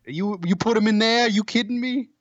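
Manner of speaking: hammed-up Boston sports fan accent. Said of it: "but with a Boston accent".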